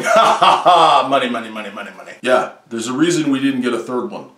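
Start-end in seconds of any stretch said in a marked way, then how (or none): 0.0-2.1 mock evil laugh then greedy muttering of money; lightly voiced cackling studio boss; greedy studio exec. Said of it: "someone was at the studio going".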